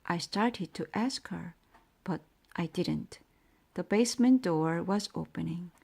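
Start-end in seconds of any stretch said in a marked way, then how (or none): none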